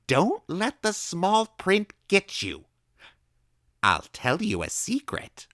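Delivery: high, loud, slow gleeful lecture; excited old woman. A narrator's reading. Old woman gleefully warning the boy about small print. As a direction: relish warning the child.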